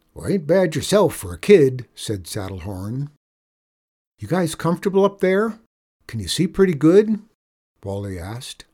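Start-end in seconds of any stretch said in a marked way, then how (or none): 0.1-1.9 higher, louder cowboy voice; folksy outlaw. Acted. Outlaw on roof praising the kid, jovial. 4.2-7.2 high, loud, eager questions; solicitous boy. Acted. Boy checking on men on roof, innocently friendly.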